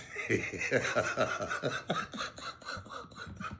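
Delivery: soft unvoiced laugh at himself; breathy laugh. Real, not acted. Host laughing softly at his own joke, breathy laugh. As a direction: laugh quietly at yourself. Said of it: "is this what I sound like".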